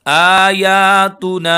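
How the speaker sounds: आयातुना with आ and या drawn equally long, slow and loud; equal long vowels. Shown as a demonstration. Teacher shows students how to stretch आयातुना, slow and long. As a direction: stretch each vowel of आयातुना the same length. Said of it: "आ या दोनों को एक जैसा खींचेंगे".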